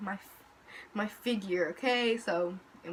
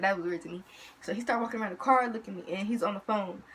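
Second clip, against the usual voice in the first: normal talking voice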